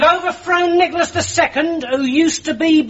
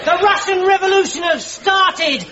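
high, urgent news-bringing cry; excited, raised-pitch bulletin; excited announcement; 4 semitones higher than usual. Acted. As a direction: proclaim big news to the whole dugout. Soldier announces revolution to officers.

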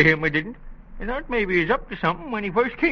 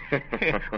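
a pleased little cackle; old man's chuckle; 7 semitones lower, 5 dB quieter than usual. Acted. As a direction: chuckle at having known all along. Old man gloats at knowing.

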